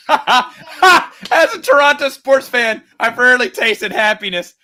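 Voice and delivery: laughing through the words, high and loud; real laughter on a sports joke. Real, not acted. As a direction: crack up while getting it out. Joke about Toronto sports, laughing.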